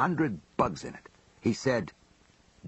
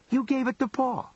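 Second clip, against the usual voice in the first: incredulous; 11 semitones higher than usual. Retold or imitated. Stunned at where money went.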